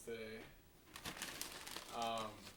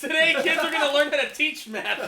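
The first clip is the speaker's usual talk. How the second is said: loud, high mock teacher; 10 semitones higher, 14 dB louder than usual. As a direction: announce like a goofy teacher. Mock teacher announcing lesson.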